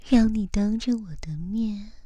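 ordinary talk, nothing marked

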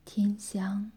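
plain reading voice

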